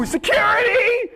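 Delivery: high-pitched yell for help; mock shout. Co-host pretending to summon guards during an imagined fight, high mock shout. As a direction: holler it out in pretend alarm.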